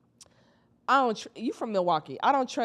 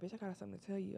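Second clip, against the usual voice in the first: much softer, hushed secret; whispered; 14 dB quieter, much faster than usual. Retold or imitated. Friend calling from the bathroom, whispering. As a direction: hush it like hiding in a bathroom. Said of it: "She whispering".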